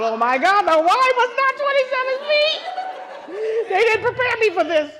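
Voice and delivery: high, loud, laughing panic; high loud laughing panic at twenty-seven feet; panicking. Retold or imitated. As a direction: shriek it half laughing, half crying. Realizing the depth, panicking.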